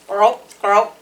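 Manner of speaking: a hint of a croaking frog. A narrator's reading. Frog calling the squirrel, lightly croaked. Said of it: "said the frog".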